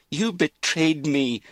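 loud, high, drawn-out accusation; outraged cry. A narrator's reading. Daughter accuses her mother of betrayal, outraged cry. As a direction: cry out the betrayal slowly and forcefully. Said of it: "Lizzie cried".

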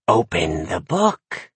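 low, slow ominous crone; ominous witch. Acted. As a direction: intone it darkly like a witch. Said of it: "she said ominously".

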